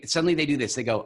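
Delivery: ordinary talk, nothing marked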